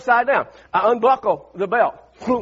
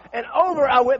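ordinary talk, nothing marked

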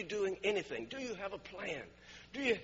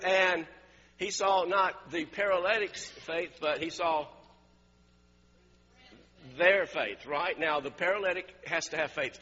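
ordinary talk, nothing marked

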